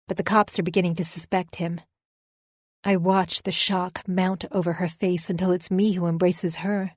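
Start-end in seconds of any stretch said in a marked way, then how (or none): none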